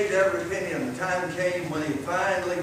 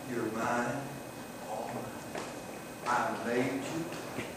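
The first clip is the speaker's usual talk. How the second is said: tender; 7 semitones lower, 14 dB quieter, slower than usual. Acted. Boy tenderly claims his boat.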